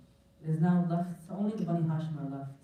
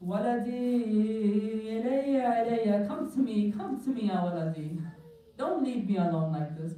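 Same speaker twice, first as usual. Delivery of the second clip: anguished calling out; 5 semitones higher, slower than usual. Retold or imitated. Imam calls out to his son leaving for the battlefield, wailing call. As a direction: call a departing son back, stretching each plea. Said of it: "calls out to him".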